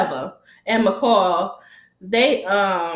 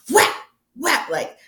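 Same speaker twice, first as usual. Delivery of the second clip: normal talking voice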